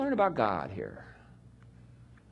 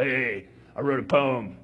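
macho Lamech. Retold or imitated. Lamech boasting to his wives, a macho swaggering brag. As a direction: boast like a swaggering tough guy. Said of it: "the macho Lamech voice".

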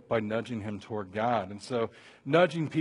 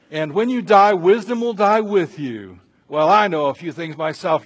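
Job sparring; 3 semitones higher, 11 dB louder than usual. Acted. Job fighting with his friends, loud and sarcastic. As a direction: throw it back at them forcefully. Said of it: "And Job says".

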